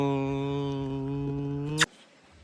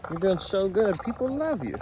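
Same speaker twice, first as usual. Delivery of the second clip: high cheerful praise; 7 semitones higher than usual. Real, not acted. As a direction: cheer the little one on. Praising child on camera.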